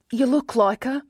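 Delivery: put-on a woman greeting warmly in an Australian accent. A narrator's reading. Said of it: "in an unmistakable Australian accent".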